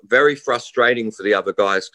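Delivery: normal talking voice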